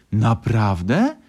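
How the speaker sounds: loud, drawn-out astonished question; amazed. A narrator's reading. Little fox hearing of grandfather the pilot, amazed. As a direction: stretch it out in wonder. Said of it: "zdziwił się mały Lisek".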